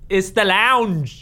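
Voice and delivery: put-on a failed, slow British accent; mock British accent, clumsy and drawn out; bad British accent. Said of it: "that one was really bad".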